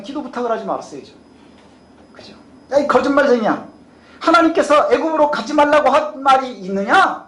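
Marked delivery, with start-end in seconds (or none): 2.7-7.2 higher-pitched indignant rebuke, acted; angry scolding. Israelites challenging Jeremiah's word from God, angrily scolding. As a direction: confront a liar with raised pitch. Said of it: "호통을 칩니다".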